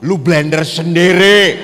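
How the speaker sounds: a rough husband barking an order; loud snap. Retold or imitated. Husband tells wife to blend it herself, loud and blunt.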